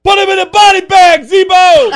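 high, loud crowd yell; fan heckle shout. Retold or imitated. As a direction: bellow it like a heckler in the stands. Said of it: "what was his comment".

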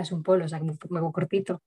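normal talking voice

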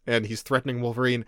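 ordinary talk, nothing marked

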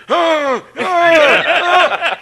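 loud, high imitated howls; hammed-up repeated wails of agony; pained cries. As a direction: howl as if badly hurt. Acting out pain under ring.

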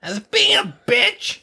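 slow, high mock whine; mocking echo. Accused friend echoing the teller, mocking whine. Mimics the accusation against himself.

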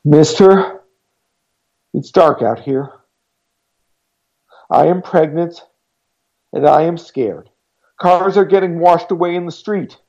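put-on scared pregnant girl pleading through the door. A narrator's reading. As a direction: beg him to let her in.

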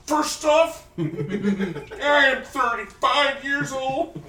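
hammed-up shouty character quote, slowing on the age; SNL sketch character. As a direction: boom it out like a washed-up life coach. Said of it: "my brain processed my age".

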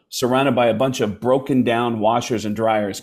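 ordinary delivery

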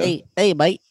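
put-on a nasal New Zealand accent. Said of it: "No, I sound nasally".

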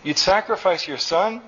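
high-pitched, appalled question; a restrained yell of disbelief. A narrator's reading. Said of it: "yelled Nectar".

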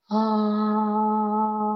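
an open held ah; heart ah tone. Shown as a demonstration. Heart chakra tone, an open held ah. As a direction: let an open ah sound from the chest. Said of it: "then you go to the heart".

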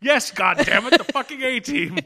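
high, delighted outburst through laughter; laughing exclamation. Real, not acted. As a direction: crack up while agreeing emphatically. Excited agreement amid laughter.

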